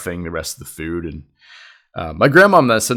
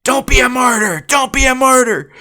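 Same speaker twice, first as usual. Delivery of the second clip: high, loud mock yell; yelling back; 7 dB louder than usual. Aunt calls mom a martyr, mock yelled retort. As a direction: imitate an aunt snapping back at full voice. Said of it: "my aunt yells back".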